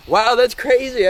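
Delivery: high, emotional laugh through the words; real laughter breaking into high-pitched words. Real, not acted. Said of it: "I'm gonna laugh it out".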